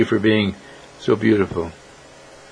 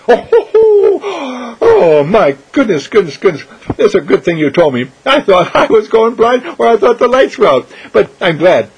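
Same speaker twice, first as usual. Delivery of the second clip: hammed-up laugh; 10 semitones higher, 5 dB louder than usual. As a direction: laugh at yourself like a clown. Clown Irene laughing at herself.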